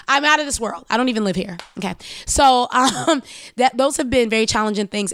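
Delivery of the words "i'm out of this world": higher and louder, a proclamation; out punched high and loud; loud proud declaration. Shown as a demonstration. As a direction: announce it to the whole pod. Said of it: "I'ma say this right here on the pod".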